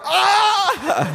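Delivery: retold scream; mock scream. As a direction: yelp like you're startled. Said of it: "I'm screaming".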